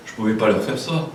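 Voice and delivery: normal talking voice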